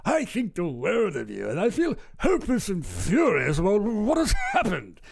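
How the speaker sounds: put-on upper-class English accent, rising toward outrage; hammed-up indignant British gentleman; mock posh British voice. Streamer mocking the politician's email in a hammed-up posh British accent. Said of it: "I just assume he talks like this".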